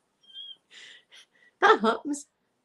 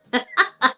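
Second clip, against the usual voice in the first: short, higher-pitched cackle; laugh; 4 semitones higher, 4 dB louder than usual. Real, not acted. Streamer boasting about being a moderator, short laugh. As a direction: laugh at your own boast.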